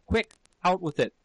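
high, urgent order; panted demand. A narrator's reading. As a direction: press for an answer at once. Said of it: "he panted".

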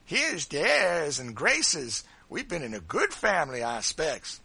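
high, loud mockery of airs; drawn-out, hammed-up haughtiness; ludicrous Adolph imitation. A narrator's reading. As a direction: mimic someone putting on graces. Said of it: "gave a ludicrous imitation of Adolph's manner".

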